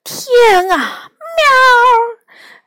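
high, wailing cat exclamation; stretched high wail of disbelief; frightened cry. A narrator's reading. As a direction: cry out in shock, ending on a high meow. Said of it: "梨花再次说".

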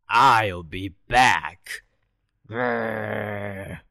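a sulky dog promising to return; growling dog. Acted.

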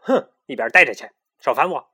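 fast, loud impatient brush-off; irritated. A narrator's reading. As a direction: snap them away. Annoyed brush-off. (不耐烦地赶人)

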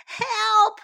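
put-on high, loud child's cry; cry for help. A narrator's reading. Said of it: "cried Sam".

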